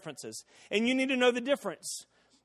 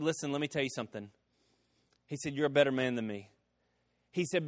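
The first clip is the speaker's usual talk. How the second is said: softer, lower, sincere apology; humble sincere; 7 semitones lower, 6 dB quieter than usual. Retold or imitated. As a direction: quietly make peace with the man. Lawyer humbly reconciling.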